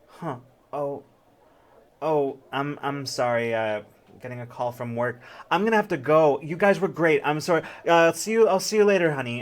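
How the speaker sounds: brushing everyone off; hurried mom excuse. Acted. Mom fakes a work call.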